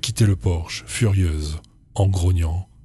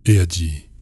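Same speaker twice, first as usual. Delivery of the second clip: ordinary delivery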